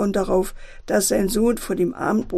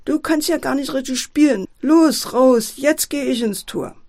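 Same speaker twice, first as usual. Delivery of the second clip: higher, louder cross father; angry snapping; 5 semitones higher, 4 dB louder than usual. A narrator's reading. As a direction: boss the boy out of the goal crossly. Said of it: "Erbost schnauzte Herr Clown". (erbost schnauzend)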